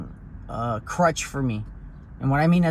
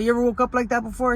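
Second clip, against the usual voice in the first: higher, fast grandfather voice; quick grandpa question; 8 semitones higher, faster than usual. Retold or imitated. Grandfather quizzing his grandson about his nights, higher and fast. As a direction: rattle it off like grandpa probing.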